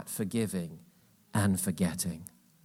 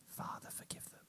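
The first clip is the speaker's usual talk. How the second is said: soft voiceless whisper; mother whispering forgiveness; whispered prayer; 24 dB quieter than usual. Retold or imitated. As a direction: breathe the words like a grieving mother. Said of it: "she accessed forgiveness".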